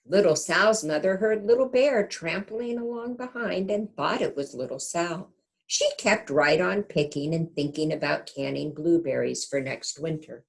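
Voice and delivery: gentle storybook narration; reading to children. A narrator's reading. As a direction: read it like a bedtime picture book. Reads picture book aloud.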